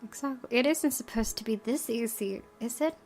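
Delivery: ordinary talk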